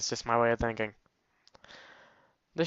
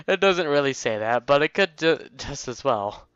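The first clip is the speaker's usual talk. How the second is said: usual speaking voice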